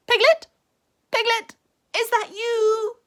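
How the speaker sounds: high, loud anxious call; frightened calling. A narrator's reading. Scared Rabbit calling for Piglet, high and anxious. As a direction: call out nervously into the fog.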